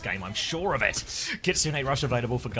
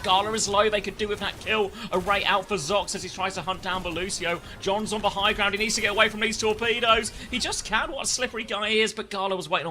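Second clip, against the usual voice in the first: higher, urgent commentary; excited play-by-play; 7 semitones higher than usual. Acted. Caster calling the chase, excited. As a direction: call the chase live.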